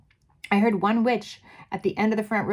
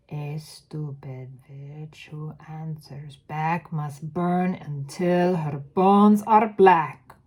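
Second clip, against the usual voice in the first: put-on slow, menacing witch accent swelling louder; Grand High Witch accent; 7 dB louder, slower than usual. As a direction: chant the rhyme in a slow foreign witch accent. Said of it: "do the accent".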